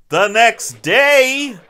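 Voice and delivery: slow, high announcement of a time jump; exaggerated scene-change call; mock announcer.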